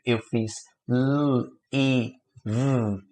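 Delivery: "L, I, V" as three separate sounds. Shown as a demonstration. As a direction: break the word into its sounds. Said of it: "Três sons que eu reproduzi". (separando os sons de leave)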